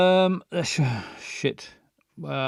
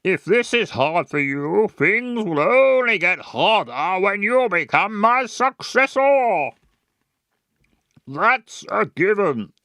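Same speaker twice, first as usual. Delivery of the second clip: louder, raised and pompous; angry posh father; 3 semitones higher, 3 dB louder than usual. Acted. Father angrily warning his daughter, posh. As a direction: lay down the law to her. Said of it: "Let's get mad".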